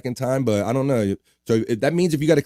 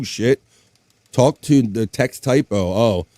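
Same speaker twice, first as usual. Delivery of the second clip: normal talking voice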